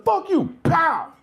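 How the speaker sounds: high, loud mock snap; hammed-up phone-slamming yell; mock angry hang-up. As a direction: slam the phone down on them.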